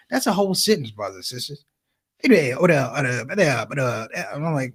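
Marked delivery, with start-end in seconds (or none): 2.2-4.1 imitated dad stuttering like a cold engine; sputtering father. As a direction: sputter in broken grunts, never finishing. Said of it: "sounds like a car trying to start in the cold".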